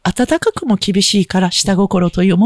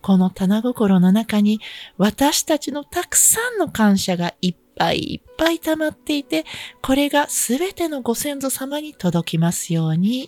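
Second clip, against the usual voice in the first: guided meditation; 2 semitones higher, 5 dB quieter than usual. Acted. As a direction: guide listeners gently into prayer. Leads a short healing for listeners.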